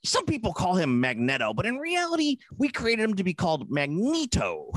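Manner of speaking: put-on high, excited old showman; Stan Lee impression. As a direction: mimic Stan Lee setting the record straight. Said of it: "And it's like".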